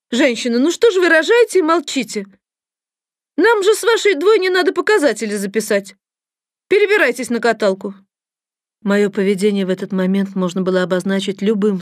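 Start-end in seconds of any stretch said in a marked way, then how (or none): 0.1-7.9 high-pitched officious scolding; indignant. A narrator's reading. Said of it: "возмущённо произнесла надо мной". (возмущённо, высоким голосом)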